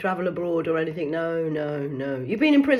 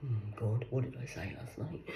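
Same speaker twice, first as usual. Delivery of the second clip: much softer aside, half to herself; muttered aside; 10 semitones lower, 15 dB quieter than usual. Acted. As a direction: drop away from the phone, worried.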